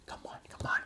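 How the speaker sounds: whispered, egging the referee on; urgent whisper. Retold or imitated. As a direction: hiss it low so only the ref hears. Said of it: "he's like".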